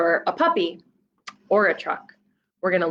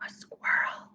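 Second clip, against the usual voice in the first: hushed excited whisper; 9 dB quieter than usual. Acted. Reader whispering the squirrel sighting to the child. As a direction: whisper it so the squirrel won't hear.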